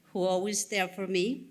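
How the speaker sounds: ordinary delivery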